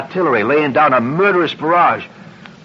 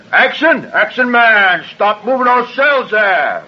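Charles Laughton impression; 6 semitones higher, slower than usual. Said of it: "it sounded just like Charles Lawton".

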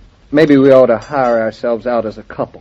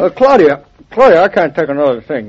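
higher, louder overloaded plea; exasperated, pitched-up complaint; flustered protest; 6 semitones higher, 4 dB louder than usual. Acted. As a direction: protest that your hands are full. Overloaded husband protests at more load.

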